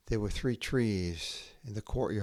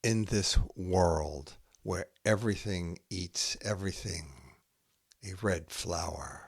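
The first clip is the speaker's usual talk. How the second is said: slow, measured haiku reading with long pauses between poems; poetry reading; slower than usual. Acted. Poet reading her own haiku to listeners, slow and measured. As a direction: let each image land before the next.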